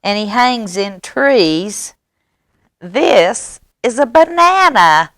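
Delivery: high, drawn-out verdict; know-it-all mouse. Acted. Mouse misnames another animal in story.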